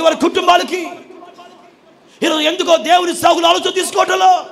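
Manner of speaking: shouted, high preaching to the congregation; full-force preaching. Acted. Pastor to his believers: loud fervent preaching.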